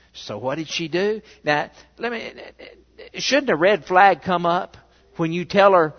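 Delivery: usual speaking voice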